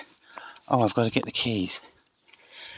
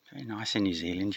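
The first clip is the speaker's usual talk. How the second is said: ordinary talk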